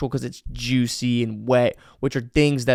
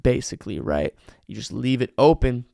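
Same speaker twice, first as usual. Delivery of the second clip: ordinary talk, nothing marked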